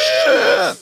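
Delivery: high, loud wheezing gasp; wheeze. Acted. Game master voices the struck foe's strange wheeze. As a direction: imitate a wounded man's wheeze. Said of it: "a strange wheezing sound".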